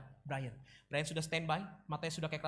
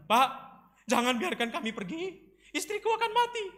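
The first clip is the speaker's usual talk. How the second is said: tearful pleading; 11 semitones higher, 6 dB louder than usual. Acted. Joseph improvises at Brian's feet, pleading desperately. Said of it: "Lalu Yusuf meneteskan air mata".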